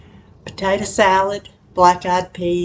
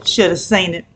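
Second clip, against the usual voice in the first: ordinary talk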